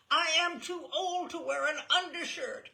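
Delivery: a re-enacted furious yell at mother; retold screaming. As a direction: yell it as the frustrated girl, reliving it. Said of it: "screaming, weeping, shouting".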